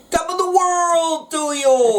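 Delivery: high, slow put-on accent with no clear country; same vague accent. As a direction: try the greeting again in that muddled accent. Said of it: "that's exactly the same as you just did".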